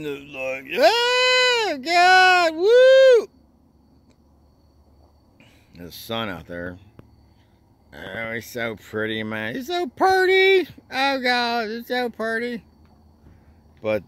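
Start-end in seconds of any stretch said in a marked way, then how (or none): 0.7-3.3 very high, loud falsetto; hammed-up whoop. Exaggerated whoop of delight. 9.5-12.6 very high falsetto, easing slightly; mock gushing. Gushing repeat about the pretty sun.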